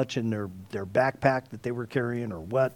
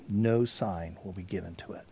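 whisper; 8 semitones lower, 8 dB quieter than usual. Acted. Jesus' solemn verdict quoted.

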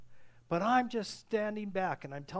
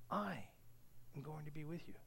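quiet, weighty reassurance; hushed promise; 2 semitones lower, 10 dB quieter than usual. Acted. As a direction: let "I" stand alone, then finish very quietly. God's promise repeated softly for effect.

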